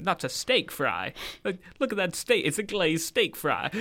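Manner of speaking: put-on mild, hammy American accent; mock American accent, lightly done; bad American accent. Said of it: "That's my really bad American accent".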